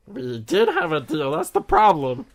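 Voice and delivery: high, slow protest; exasperated protest. Acted. Repairer haggling with the android, exasperated. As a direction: push the pitch way up in exasperation. Haggling in character over payment.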